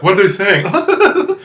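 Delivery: high, drawn-out laughing question; mock giggly onlooker; imitated laughing. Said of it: "they're all laughing".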